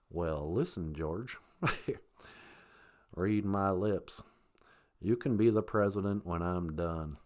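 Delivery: very low, soft presidential father; George Bush Senior. Acted. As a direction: answer deep and quiet, drawing out words. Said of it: "that's what they sounded like".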